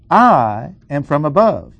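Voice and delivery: "I" punched long and strong, then a pause; stress on I. Shown as a demonstration. Teacher reading the verse to show contrast, stressing "I". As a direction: set "I" apart with heavy emphasis against the rest. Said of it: "I will stress the four emphatic pronouns".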